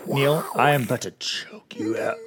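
whispered, voiceless mock menace; Star Wars Force threat. Podcaster play-threatening the co-host, whispered Star Wars villainy. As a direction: pretend to Force-choke him in a whisper. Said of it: "a weird Star Wars Force thing".

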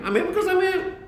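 creation's smug answer. Retold or imitated. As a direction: squeak it out like the world shrugging off the question. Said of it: "we ask Yesha Nivra".

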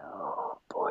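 hushed, breathless dismay; whispered oh boy. Retold or imitated. Left alone on a stranger's couch, a hushed whisper. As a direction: whisper it in quiet disbelief.

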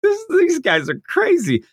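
squeaky high exasperated mock aside. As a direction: pitch it way up, like rolling your eyes. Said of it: "and I'm like".

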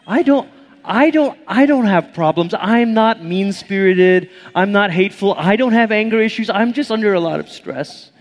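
high, defensive excuse-making voice; mock whiny self-justifying; self-excusing whine. Churchgoer excusing himself, mock whiny defensive. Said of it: "We're like".

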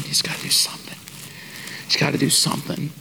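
whispered plea, under the breath; mumbled prayer. Retold or imitated. Waiting in the church line, she whispers a prayer to God. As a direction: murmur it to God so nobody in line hears. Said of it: "I'm mumbling to God under my breath".

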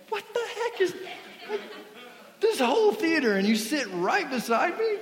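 high-pitched laughing outrage at a stranger; outraged disbelief. Retold or imitated. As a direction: act baffled that he sat right next to you in an empty theater. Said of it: "I'm like".

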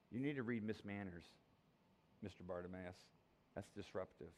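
usual speaking voice

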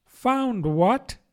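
high-pitched duck character; questioning duck. A narrator's reading. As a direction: interrupt with a high, puzzled question. Duck interrupts the mouse's lecture.

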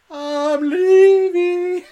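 very high, very slow drawn-out cry of leaving; dwarf leaving. Acted. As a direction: stretch it out high and long like a fleeing dwarf. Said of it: "you hear the dwarf going".

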